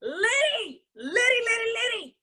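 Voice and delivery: high-pitched stretched calls; excited hype. Real, not acted. Host loving the act he heard, high excited calls. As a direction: cry it out high in delight.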